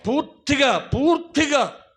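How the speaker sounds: high, loud, stretched preaching cry; emphatic shout. Real, not acted. Preacher crying out to the congregation, loud and drawn out. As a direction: hammer the word home from the pulpit. Preacher insists on being filled.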